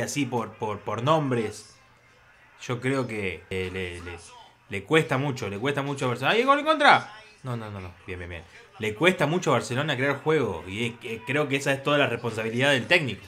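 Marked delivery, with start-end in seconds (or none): 6.3-7.1 sudden high, loud yelp of alarm; alarmed cry. Real, not acted. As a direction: break off in panic at an own goal. Alarm interrupts his comment. (grito repentino de alarma)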